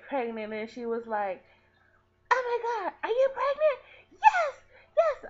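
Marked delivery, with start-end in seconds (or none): 2.3-5.2 shrieking high with laughter and near tears; mom's ecstatic laughing cry; overjoyed squeal. Retold or imitated. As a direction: scream it out in joyful disbelief. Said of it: "like, so happy".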